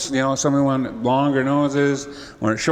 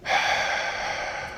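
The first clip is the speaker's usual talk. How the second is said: long unvoiced deep breath in; 9 dB quieter than usual. Creator breathes in deep before his magic.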